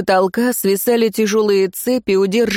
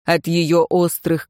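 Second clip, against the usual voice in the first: plain reading voice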